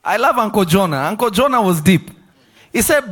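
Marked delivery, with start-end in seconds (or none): none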